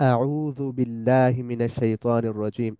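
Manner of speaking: slow recited Arabic in a chanting tone; slow devotional recitation; Quranic recitation. Shown as a demonstration. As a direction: recite it slowly, in tajweed style. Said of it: "যেমন".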